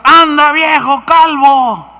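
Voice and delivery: high, loud, slow jeering shout; mocking yell. Boys jeering at the prophet, loud slow taunt. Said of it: "le empezaron a gritar".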